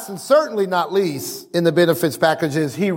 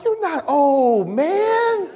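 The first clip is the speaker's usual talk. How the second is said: very high, drawn-out protest; exasperated exclamation; 8 semitones higher, much slower than usual. Acted. Preacher protesting to someone acting old in their sixties, exasperated high cry. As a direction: stretch it up high in disbelief.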